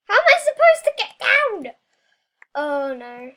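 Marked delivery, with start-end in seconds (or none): 0.1-1.7 high panicked yell; yelling across the room. Real, not acted. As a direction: shout in a frantic panic.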